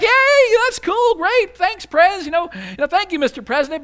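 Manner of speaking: loud, high-pitched gleeful cheer; excited cheering. Acted. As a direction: whoop it up like your debts just vanished. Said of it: "we'd be excited about that".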